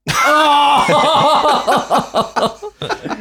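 shrieking burst of laughter. Real, not acted. Laughing at the dog biscuit story.

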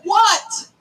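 "What" repeated as its own emphatic beat; underlined What. Shown as a demonstration. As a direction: underline the word "What" each time. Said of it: "Underline that".

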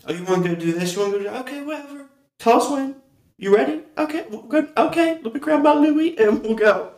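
mom impression. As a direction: play your mom happily agreeing to anything. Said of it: "That'd be my mama".

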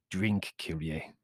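low, slow invitation; grinning manservant. A narrator's reading. Servant urging guest to drink, low and slow. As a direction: urge it slowly in a low voice.

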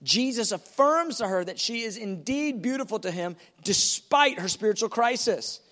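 normal talking voice

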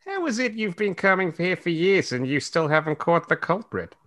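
lightly voiced young woman. Reads Chris's lines in a put-on voice.

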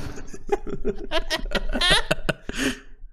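airy giggle, high and unvoiced; high breathy laugh. Real, not acted. Laughing at own joke.

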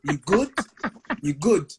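imitated forceful check-in; mock aggressive. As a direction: fire friendly questions as mild challenges. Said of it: "You can't say nothing weak".

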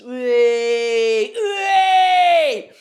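high, loud drawn-out squeal; mock cheer. Mock cheer at Old Spice pun.

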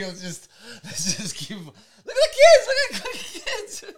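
high, slow, laughing coaxing; distracting angry friend, laughing and pleading. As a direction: coax her through laughter to look at kids.

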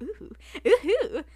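high, loud, slow "ooh-hoo"; hammed-up sassy cooing. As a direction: swoop up on a teasing hoot. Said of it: "Very sassy".

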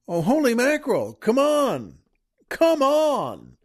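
high, hammed-up disbelief; amazed exclamation. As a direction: exclaim in mock awe, pitched well up. Acts out awe at her beauty.

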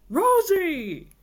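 cheering. A narrator's reading. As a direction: cheer her name in delight. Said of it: "cheered Boris".

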